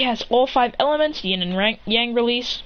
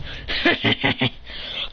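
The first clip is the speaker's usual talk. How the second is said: low, voiceless villain-style chuckle; acted chuckle; 10 semitones lower, 4 dB quieter than usual. Hero trapping them in genjutsu, low acted chuckle. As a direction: fake a low breath-only laugh.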